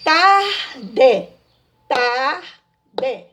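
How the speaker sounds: "Tarde" split as tar, pause, de; syllable by syllable. Shown as a demonstration. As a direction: say "Tarde" slowly in two separate syllables. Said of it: "separar a sílabas".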